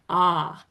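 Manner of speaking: "Ah" held like the letter R's name; low, open "Ah" for pupils to copy. Shown as a demonstration. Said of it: "picture the letter R".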